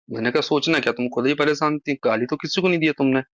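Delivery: ordinary delivery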